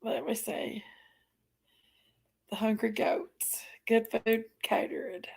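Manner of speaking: soft, breathy whisper read off the screen. Real, not acted. Reading her restaurant page to herself, soft whisper. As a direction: whisper it to yourself while reading.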